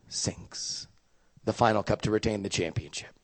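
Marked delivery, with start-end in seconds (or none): 0.1-0.9 breathless whisper of suspense. Retold or imitated. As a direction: hush it like a clutch moment. Tense final shot.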